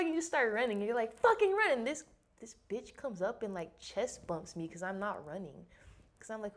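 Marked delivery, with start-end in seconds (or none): 1.1-1.7 high, loud imitated instructor yell; shouted order. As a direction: yell it like boot camp staff. Instructors yelling at recruits, acted.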